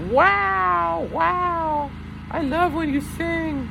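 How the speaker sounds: very high mock-admiring voice, drawn out; exaggerated high-pitched wows; gushing fans. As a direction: imitate awestruck listeners in a slow falsetto. Acting out gushing online listeners.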